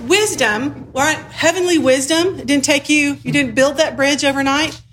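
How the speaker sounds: normal talking voice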